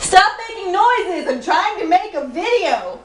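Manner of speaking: high, slow, drawn-out complaint; exasperated off-camera scolding; annoyed yell. Real, not acted. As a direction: call out to the noisemaker, stretching each word. Scolding someone off camera for noise.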